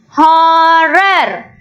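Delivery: "Horror" stretched out as hor-ror; split into syllables. Shown as a demonstration. Teacher showing where horror splits, "Horror" split syllable by syllable. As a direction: say "Horror" in two long, even syllables. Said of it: "The sounds are splitting here".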